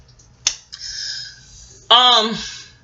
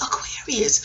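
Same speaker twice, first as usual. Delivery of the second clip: whispered answer; 7 dB quieter, slower than usual. Retold or imitated.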